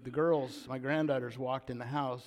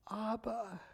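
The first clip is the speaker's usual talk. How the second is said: high, soft, stricken cry; imitated cry of a bereaved daughter; 8 semitones higher, 4 dB quieter than usual. Daughter finding her dad dead, high stricken cry. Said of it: "she cries out".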